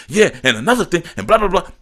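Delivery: mock ranting. Mocking a person screaming at him, mock rant. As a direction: parody someone going off. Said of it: "going off and screaming".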